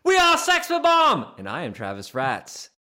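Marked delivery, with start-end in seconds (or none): 0.0-1.3 high, slow and loud band shout; shouted rock intro. Acted. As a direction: announce it like a rock star.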